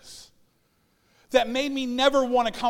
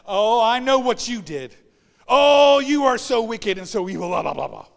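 louder, sharp mock accusation; accusing rebuke; 8 dB louder than usual. Accuser condemning someone as wicked, loud mock scolding. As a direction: hurl the accusation at them. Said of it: "somebody looks at you and says".

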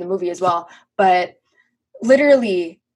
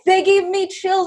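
high, slow mock-tearful gush; hammed-up emotional whine; overcome fan; 11 semitones higher than usual. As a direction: draw it out as if welling up. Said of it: "I was getting chills".

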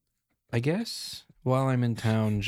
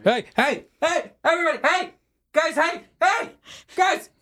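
high, loud repeated shouts; frantic high-pitched hailing; calling out; 8 dB louder than usual. Acted. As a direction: holler for the group's attention while running up. Gerald calls while running up.

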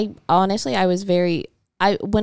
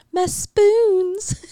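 mock Irish wail; 5 dB louder than usual. Imitating Far and Away heroine.

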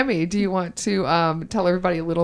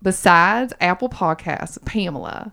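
slow put-on Southern drawl; Southern accent. Host quizzes co-host on podcast sites in a Southern accent. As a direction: set the quiz in a Southern accent. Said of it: "I have to go back into it".

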